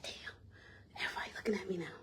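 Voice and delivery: hushed embarrassed whisper; embarrassed whisper under her breath; whispered aside. Retold or imitated. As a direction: breathe it under your breath as all eyes turn. Embarrassed, everyone staring.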